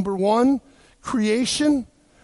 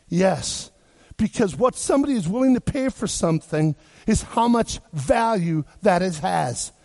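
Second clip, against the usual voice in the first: ordinary delivery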